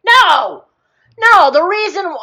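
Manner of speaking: loud, high cry of no; real frustrated outburst. Real, not acted. Teller catching their own mistake in the story, frustrated outburst. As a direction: stop yourself with a loud protest. Teller realizes she botched the retelling.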